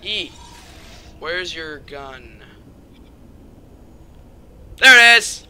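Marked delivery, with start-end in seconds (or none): none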